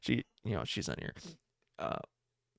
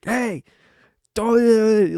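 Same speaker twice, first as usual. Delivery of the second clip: raised, loud retold objection; mock protest; 7 semitones higher, 10 dB louder than usual. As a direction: imitate her calling out, higher and louder. Quoting girlfriend catching him.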